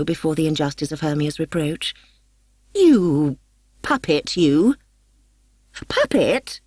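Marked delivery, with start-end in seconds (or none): 2.7-4.7 loud spat insult; sneering taunt; scornful insult. A narrator's reading. Helena insulting Hermia. 5.9-6.6 high outraged shriek on Puppet; indignant squeal at the insult. A narrator's reading. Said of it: "shrieked Hermia".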